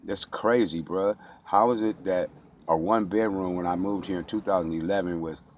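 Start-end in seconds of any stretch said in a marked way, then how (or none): none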